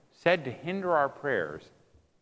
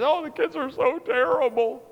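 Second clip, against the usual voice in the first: very high, put-on wail; complaining wife; 12 semitones higher, 3 dB louder than usual. Wife venting to husband about kids, high complaint. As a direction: lament it in an exaggerated wife voice. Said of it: "you know, when she says".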